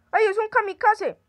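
high loud alarmed cry; startled shout. Real, not acted. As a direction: yelp in sudden alarm. Sudden exploding zombie appears.

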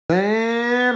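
usual speaking voice

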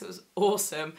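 normal talking voice

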